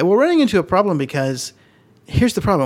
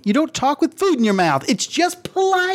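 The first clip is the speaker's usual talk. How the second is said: scolding; 10 semitones higher than usual. Real, not acted. Scolding partner for chewing.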